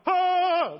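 sudden high yelp of fright, loud; mock startled shriek. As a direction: jump and shriek like the disciples. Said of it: "all of a sudden they're like".

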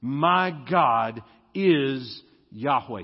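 slow, "my" stressed; emphatic my. Shown as a demonstration. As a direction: proclaim slowly, lean on "my". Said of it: "That's what Elijah as a name means".

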